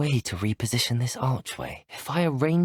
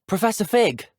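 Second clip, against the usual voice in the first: higher, urgent call; 9 semitones higher, 4 dB louder than usual. Acted. Student calls out to Professor Fig, calling out.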